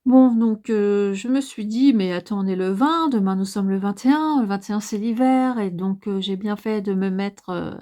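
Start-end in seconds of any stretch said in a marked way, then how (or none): none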